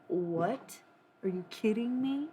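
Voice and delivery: loud, worked-up ranting; getting loud. Real, not acted. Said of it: "I'm getting a little loud about Jesus".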